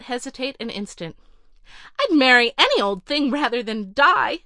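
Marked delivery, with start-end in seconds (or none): 2.0-4.4 put-on louder, desperate cry of a sick rich girl; a dying heiress blurting out her choice; cried out. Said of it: "she cried".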